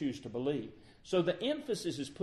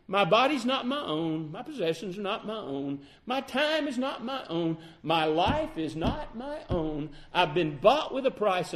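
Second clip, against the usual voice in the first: raised-pitch declaration, each phrase driven home; impassioned preaching; 6 semitones higher, 4 dB louder than usual. Real, not acted. Preacher declaring his life not his own, impassioned. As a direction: preach it as a personal vow.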